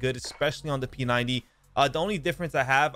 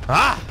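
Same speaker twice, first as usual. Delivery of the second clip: loud startled yell; 4 semitones higher, 9 dB louder than usual. Real, not acted. Sudden hit in game.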